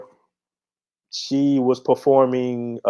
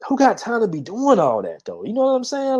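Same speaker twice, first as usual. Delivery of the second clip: mock high exclamation; 11 semitones higher than usual. Real, not acted.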